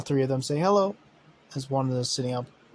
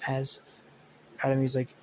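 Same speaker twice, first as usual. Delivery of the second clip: ordinary talk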